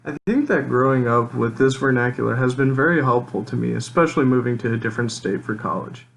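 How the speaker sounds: ordinary delivery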